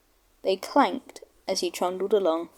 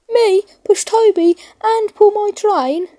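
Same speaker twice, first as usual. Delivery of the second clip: much higher and louder outraged engine; indignant snort; 12 semitones higher, 7 dB louder than usual. Acted. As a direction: scoff at the very idea. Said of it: "snorted James".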